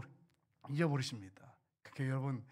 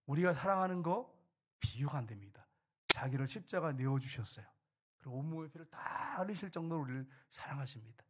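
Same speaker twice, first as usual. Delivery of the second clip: ordinary delivery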